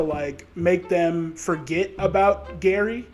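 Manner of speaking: usual speaking voice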